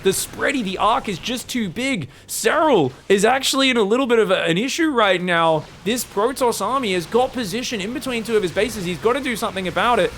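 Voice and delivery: ordinary talk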